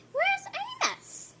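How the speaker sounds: very high, slow animal voice asking; worried animals. Acted. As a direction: ask like the little zoo animals, concerned. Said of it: "the animals wondered".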